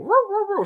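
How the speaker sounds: high yappy "roo" dog barks, mock; imitated dog bark. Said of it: "and she don't go".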